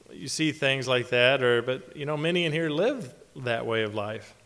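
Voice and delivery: ordinary delivery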